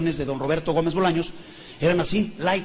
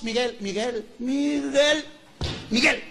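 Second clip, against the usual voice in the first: higher, insistent calling of a name; Josefa interrupting; 4 semitones higher, much slower than usual. Acted. Josefa stopping Miguel mid-speech, insistent calling. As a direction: cut into his speech to get his attention.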